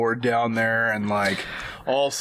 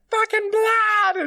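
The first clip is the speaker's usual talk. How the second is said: high, loud, slow eerie mutter; slow high creepy drawl; 4 dB louder, slower than usual. Acted. Son staring at the doll, slow, high and loud. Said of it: "Andrew's sitting there like".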